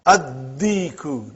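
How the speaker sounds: addiku, the d doubled with no l sound; Arabic sun letter demo; assimilated addiku. Shown as a demonstration. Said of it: "not aldiku".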